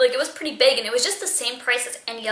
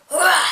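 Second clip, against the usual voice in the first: sudden loud "Wah!" sneeze, pitched high; imitated sneeze; 6 semitones higher, 6 dB louder than usual. Retelling the ghost's sneeze heard from the bathroom, a loud high "Wah!". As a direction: blurt it out like a strange, loud sneeze. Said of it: "this time it was more like a".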